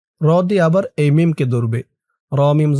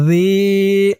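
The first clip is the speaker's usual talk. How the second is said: "জি" held long and level; one-alif stretch; 4 semitones higher than usual. Shown as a demonstration. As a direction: draw out the ee of "জি" for one beat. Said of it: "জি এক আলিফ টান".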